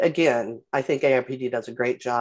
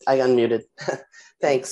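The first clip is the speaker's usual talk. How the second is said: normal talking voice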